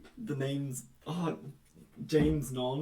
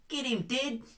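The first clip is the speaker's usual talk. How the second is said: put-on thick Kiwi accent, high and punchy; New Zealand accent; 13 semitones higher, slower than usual. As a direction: bark it like a broad Kiwi. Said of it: "Tom's attempt at a New Zealand accent".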